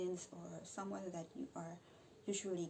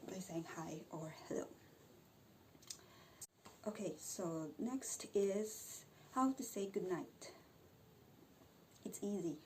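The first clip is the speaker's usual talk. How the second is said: ordinary talk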